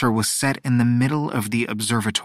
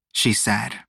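usual speaking voice

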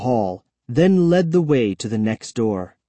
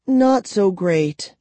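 glum; 8 semitones higher, slower than usual. Acted. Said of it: "Kara replied glumly".

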